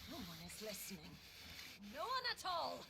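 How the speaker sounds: building to a shout. Acted. Villain's taunt builds to outburst.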